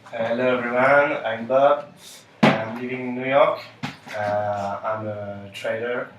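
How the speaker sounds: usual speaking voice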